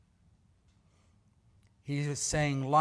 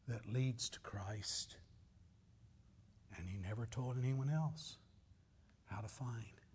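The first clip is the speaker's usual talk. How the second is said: hushed whisper; 4 semitones lower, 10 dB quieter than usual. Real, not acted. Preacher whispering to the congregation about Christ. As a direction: drop to a whisper to draw them in.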